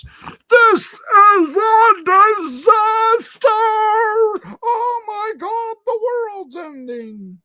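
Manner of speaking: high, loud, slow hammed-up panic; mock panic. Mocking coworkers at work, a hammed-up panicked wail. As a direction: ham up a coworker's meltdown. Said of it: "everything seems to build up to a crisis".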